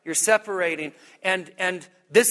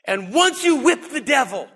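high, punchy rallying cry; hammed-up victory charge, pitched up; mock triumph; 6 semitones higher, 3 dB louder than usual. Preacher misquoting Jesus as a victory speech, mock triumph. Deliberately wrong triumphant version of the verse.

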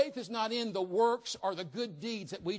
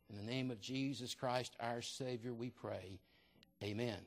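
lower, softer praying voice; prayer; 5 semitones lower, 4 dB quieter than usual. Real, not acted. Pastor praying for the congregation before the message, lower and softer. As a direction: pray reverently, easing down toward the amen.

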